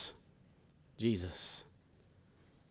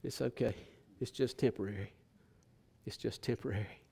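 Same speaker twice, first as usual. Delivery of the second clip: much softer, lower, gentle murmur, voiced not whispered; soft whisper-like reassurance; 4 semitones lower, 13 dB quieter than usual. Jesus comforting the preacher in his ear, soft and low. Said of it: "Jesus whispers in my ear and says".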